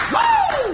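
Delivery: high, loud woo; party whoop. Acted. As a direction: whoop like the bar is lit. Turn it up, partying.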